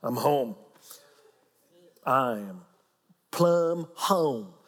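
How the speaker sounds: acted contented exhale, dropped low; relaxed. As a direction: let go and sink into being home. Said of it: "I just go, "Oh, I'm relaxed".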